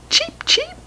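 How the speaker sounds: very high chick peeps; chick cheep. Pretend. Reading to a child, high baby chick cheeps. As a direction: peep it tiny and high. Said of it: "the chick cried".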